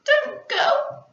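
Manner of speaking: high, loud desperate plea; begging. Acted. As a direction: beg them to stay. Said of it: "begged Pip".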